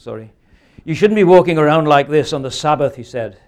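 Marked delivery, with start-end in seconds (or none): 0.8-3.0 loud telling-off, accent attempt dropped; scolding kirk elder. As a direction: scold loudly as a church elder, in your own accent. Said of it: "accosted by an elder of the kirk".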